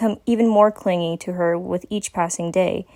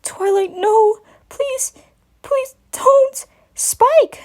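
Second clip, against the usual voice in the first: high, crying plea that grows louder; crying out in sleep; 3 dB louder, much slower than usual. Acted. Spike begging Twilight in his nightmare, high crying plea. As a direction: sob and beg as the nightmare peaks. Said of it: "His voice started to come even louder".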